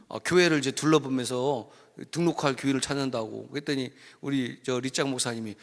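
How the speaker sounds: normal talking voice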